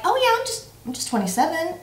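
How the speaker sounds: airy, carefree, jumping high on yeah; a playful mock-young inner voice; breezy self-talk. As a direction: play it like you're still young and nothing matters. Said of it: "internally I'm like".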